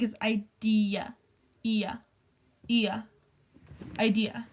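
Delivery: idea drawn out as "eye-DEE-yuh"; British-style idea. Retold or imitated. Imitating British people saying idea, drawn-out pronunciation. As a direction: stretch the end of idea into a separate yuh. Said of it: "when British people say the word idea".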